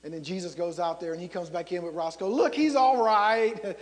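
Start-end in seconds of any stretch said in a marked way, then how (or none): 2.3-3.8 loud, drawn-out reassurance; cheerful announcement. Acted. Young Jesus showing the revived dog, cheerfully loud. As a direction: call it out loudly, stretching the words.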